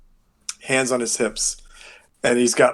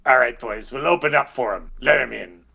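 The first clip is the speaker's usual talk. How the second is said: guard giving orders. Acted. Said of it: "all of a sudden you hear".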